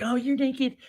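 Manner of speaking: preacher mocking his wife when she is angry, in a high mock voice. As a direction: squeak it out like a scandalised wife, mocking her. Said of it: "I'd be like".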